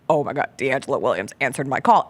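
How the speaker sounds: low-pitched stunned reaction; retold shock. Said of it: "and I was shocked".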